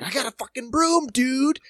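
high, loud retold threat; exasperated mock yell; confronting yell. Retells confronting possum with broom.

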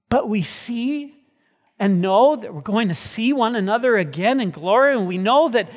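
high, loud, fervent preaching; preaching at full force. Acted. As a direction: lift the congregation with rising fervor.

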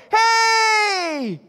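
high, loud drawn-out holler; long joyful child's shout; excited kid greeting. Acted. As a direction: squeal hello like a running child. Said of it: "come and go".

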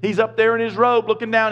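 ordinary delivery

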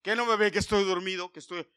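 loud, high annoyed yell; annoyed shout. Retold or imitated. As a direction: snap at them to leave you alone. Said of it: "Y digo".

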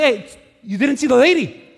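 loud, high-pitched challenge; indignant yelling. Retold or imitated. Pastor confronting a man who cut in front of a mom, yelling. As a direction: call him out at full volume. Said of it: "But I started yelling".

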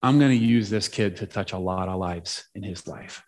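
low, hushed solemn pronouncement; voice of God. Retold or imitated. God speaking to the preacher, low solemn retold voice. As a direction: speak low and measured, as a divine inner voice. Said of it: "it was God definitely saying".

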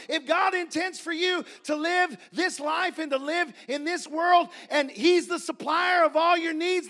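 rhythmic rising list building to a high shout; full-force preaching. Real, not acted. Preacher assuring church God will supply, full-force preaching. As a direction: drive it harder phrase by phrase to a loud peak.

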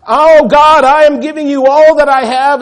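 loud, high, showy proclaiming; mock pious fanfare, loud and high. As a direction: make a grand public show of your giving. Showy temple giver proclaiming loudly.

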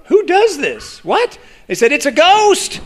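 high-pitched mock panic; mock terror. Frightened disciples seeing Jesus on the water, mock terror. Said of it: "they cried out for fear".

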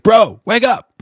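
high, loud call to wake him; coworker's shout. Retold or imitated. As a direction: yell to shake a sleeper awake. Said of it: "He's like".

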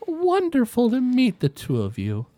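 put-on high, sweet elderly lady; a gracious old woman delighted by visitors. Old woman greeting Alice and Hannibal, high sweet voice.

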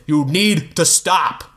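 slow pleading dad. Acted. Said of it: "Bob pleaded".

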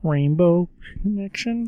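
high, slow Kermit voice; Kermit the Frog voice. Shown as a demonstration. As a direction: do your Kermit impression. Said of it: "the Kermit the Frog impression".

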